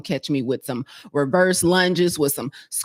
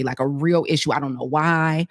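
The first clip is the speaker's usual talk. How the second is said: usual speaking voice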